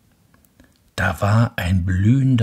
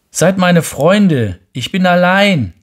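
calling out; 10 semitones higher, 8 dB louder than usual. Acted. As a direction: call out longing for friends. Lonely prince calls out.

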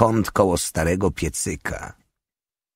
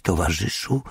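hesitant attempt. A narrator's reading. Old prisoner tries saying comrade, hesitant. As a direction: try out an unfamiliar word. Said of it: "Minęła chwila, zanim pojawiły się słowa".

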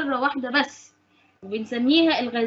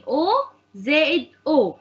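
slow spelled-out formula; much slower than usual. Shown as a demonstration. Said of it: "teacher spells out the formula".

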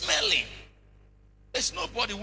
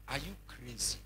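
"Are you crazy?" asked much softer, gently; soft innocent question; 8 semitones lower, 10 dB quieter than usual. Shown as a demonstration. Preacher showing the question was gentle, asked softly. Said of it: "I only asked you".

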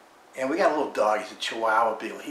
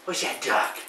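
whisper; 3 semitones higher than usual. Real, not acted. Teasing the dog with toy.

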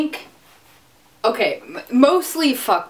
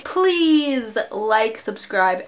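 usual speaking voice

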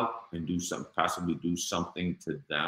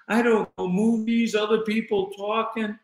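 high, louder, imitating a flustered scared wife; retold fear; 14 semitones higher, 5 dB louder than usual. Scared wife explains herself to her husband, retold fear. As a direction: answer high, as the frightened wife. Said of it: "I'm scared".

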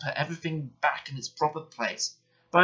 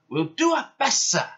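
high-pitched, keen little engines; eager twins; 11 semitones higher, 4 dB louder than usual. A narrator's reading. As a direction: answer brightly and high, eager to obey. Twins reply eagerly to their boss.

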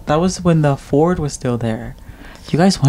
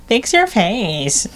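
slow, higher, drawn-out mock scold; mocking coworker; 7 semitones higher, much slower than usual. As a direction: drag out each word like a nagging coworker. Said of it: "and she goes".

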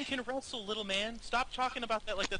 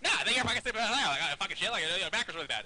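mock rival player; 4 dB louder, faster than usual. Host voicing the rival's imagined answer, mocking imitation. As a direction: put on the rival's excitable voice, rattling it off. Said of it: "And you'd be like".

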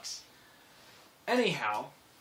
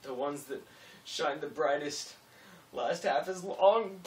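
mock farewell; 3 semitones higher, 5 dB louder than usual. Farewell speech to the dying fire.

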